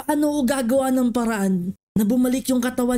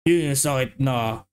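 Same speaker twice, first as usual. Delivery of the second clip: deep elder voice; 12 semitones lower than usual. Acted. Villager answering a question about a disease, in a low grave voice. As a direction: drop way down and speak like a solemn elder.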